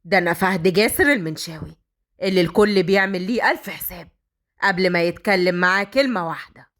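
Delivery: low, fast, furious boss; angry boss. Acted. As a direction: bark at the client from deep down. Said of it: "فهد قال بغضب".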